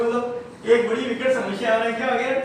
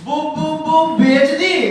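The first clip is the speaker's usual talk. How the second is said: put-on stuttering boy; 6 semitones higher, 5 dB louder, slower than usual. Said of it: "तुतला के बोलता है".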